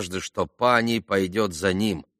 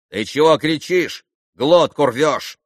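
higher, louder, brusque reproof; rude; 5 semitones higher, 5 dB louder than usual. A narrator's reading. Landlord confronts the yelling pan, rudely. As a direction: tell him off with open rudeness. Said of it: "с какой-то непонятной даже невежливостью".

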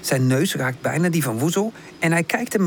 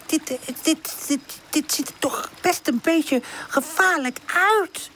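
put-on high, halting, frightened young voice; hesitant, trembling little character; 9 semitones higher than usual. A narrator's reading. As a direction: falter and stutter nervously. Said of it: "Zijn stem trilt".

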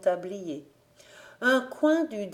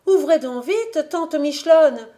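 higher, louder call through a door; urgent call; 8 semitones higher, 7 dB louder than usual. Acted. Rousille calling to her aunt through the shut door, higher and louder. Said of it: "dit-elle en frappant du poing".

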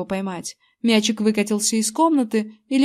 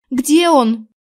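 anxious, louder and punched; anxious question; 3 semitones higher, 6 dB louder than usual. A narrator's reading. April asks where the vanished boy is, anxious. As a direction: ask it with urgent worry. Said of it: "тревожно спросила Эйприл".

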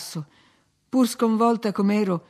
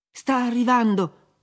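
urgent, higher and louder exclamation; 4 semitones higher, 5 dB louder than usual. A narrator's reading. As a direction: call out a warning, drawn out.

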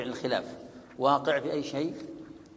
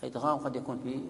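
usual speaking voice

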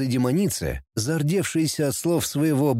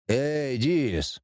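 plain reading voice

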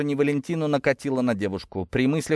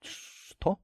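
voiceless, shocked whisper; 9 dB quieter than usual. A narrator's reading. Cousin stunned by the wedding news, whispering. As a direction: whisper it in disbelief. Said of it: "прошептала Валя".

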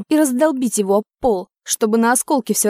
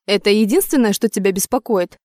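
plain reading voice

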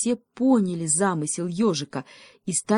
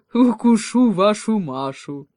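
loud, drawn-out playful threat; menacing wolf cub; 4 semitones higher, 7 dB louder than usual. Acted. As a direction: threaten slowly, louder and higher. Волчонок угрожает детям. (протяжная угроза волчонка)

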